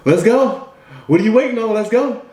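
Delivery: high, eager rallying call; pumped-up coach-like urging; urging someone on. Acted. Acts God urging listener on.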